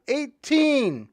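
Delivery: high, loud yelled number; old prisoner shouting out a joke number. Retold or imitated. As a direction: jump up and bark out the number. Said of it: "he yelled".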